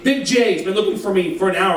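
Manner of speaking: normal talking voice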